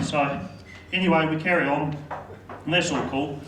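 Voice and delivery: ordinary delivery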